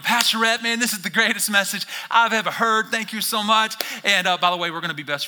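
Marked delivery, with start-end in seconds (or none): 0.0-3.7 enthusiastic fan. Retold or imitated. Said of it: "you're going".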